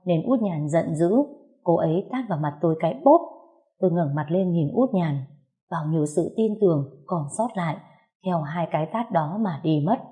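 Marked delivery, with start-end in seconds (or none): none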